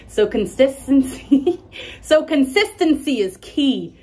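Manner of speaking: high, loud laughing through the word. Real, not acted. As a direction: crack up while saying it. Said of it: "I have a lisp".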